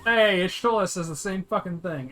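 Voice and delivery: usual speaking voice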